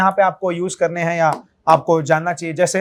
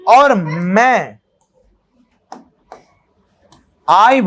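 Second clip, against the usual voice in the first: slow sample greeting. Shown as a demonstration. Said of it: "आप greet करेंगे".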